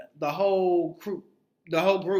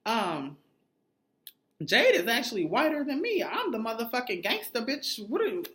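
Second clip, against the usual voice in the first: mock Chanel butting in; 8 semitones higher than usual. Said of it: "gonna say".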